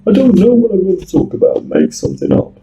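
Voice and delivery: high, fast mock drunk rambling; quoting a tipsy caller in a high voice; drunk man. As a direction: play the aimless drunk caller, lightly. Drunk man wants to chat.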